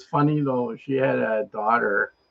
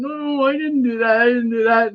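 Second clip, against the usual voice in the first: high-pitched mock protest; embarrassed mother's denial; 9 semitones higher than usual. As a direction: imitate a mother hastily denying it in public. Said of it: "And the mother's going".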